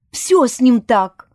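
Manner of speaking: slow, emphatic indignant retort. A narrator's reading. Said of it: "возмутилась я за Велеславия".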